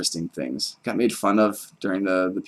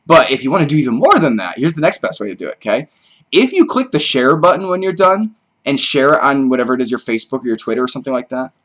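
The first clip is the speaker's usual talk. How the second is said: loud, higher, eager pitch; excited; 5 semitones higher, 6 dB louder than usual. Real, not acted. Telling viewers how sharing helps, getting carried away with excitement. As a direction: urge them on with raised, excited energy. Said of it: "I got excited".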